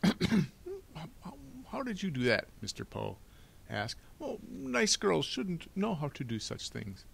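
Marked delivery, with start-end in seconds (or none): 0.0-2.5 high, stammering banker; flustered Mister Poe, astonished. Acted. Banker asking about the picked lock, flustered. 4.2-7.1 high, slow, prim banker; fussy Mister Poe, disapproving. Acted. Banker chiding the girl about lock picking, fussy disapproval.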